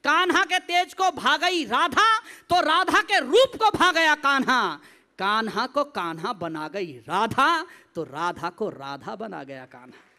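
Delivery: slow verse sinking low at the close; lilting stage poet; devotional poetry recitation. Acted. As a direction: draw out the rhymes, lowering the final lines.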